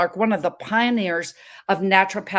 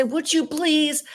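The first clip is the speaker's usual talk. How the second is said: mock polite plea; 7 semitones higher, slower than usual. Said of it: "I didn't say".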